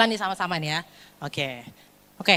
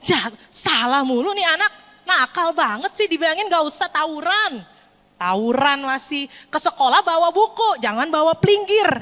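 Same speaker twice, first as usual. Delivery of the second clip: raised-pitch nagging telling-off; scolding parent; 4 semitones higher than usual. Acted. Parent scolding a brawling schoolboy, exasperated. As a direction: rattle off complaints at the naughty child. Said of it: "Apakah kita kayak gitu?".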